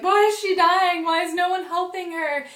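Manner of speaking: imitated hysterical crying question; distraught wail. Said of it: "I am crying my eyes out".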